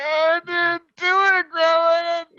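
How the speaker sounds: high whiny fake sob, imitating himself as a boy; mock crying. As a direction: whimper it out like a kid caught. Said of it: "get the fucking cries".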